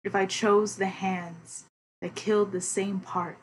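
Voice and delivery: measured spoken word recitation; poem reading. Acted. Reads own poem aloud.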